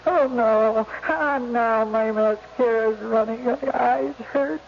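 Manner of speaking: high, tearful and distressed. Acted. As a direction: choke back tears. Crying while recording suicide tape.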